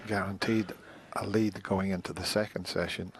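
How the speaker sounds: ordinary talk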